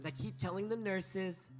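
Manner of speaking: ordinary delivery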